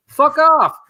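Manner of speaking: high, loud, fed-up brush-off; angry dismissal. Real, not acted. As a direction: spit it out in disgust. Angry rant about state leaders.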